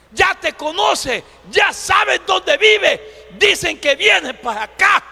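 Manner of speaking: high, loud, insistent alarm; acted agitated shouting, phrase by phrase; urgent warning. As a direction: warn him loudly that danger is coming. People warn a man death is coming.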